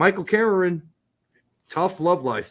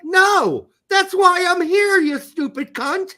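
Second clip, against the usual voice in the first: high-pitched, exasperated outburst at an ad; hammed-up outrage, voice pushed way up; mock indignant rant; 12 semitones higher, 3 dB louder than usual. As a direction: argue back at the commercial in comic fury. Mock angry retort to a commercial.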